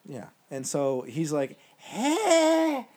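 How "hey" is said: high, loud acted-out "hey"; mock shout. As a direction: yell it long and high, imitating a player. Acts out player after "he's like.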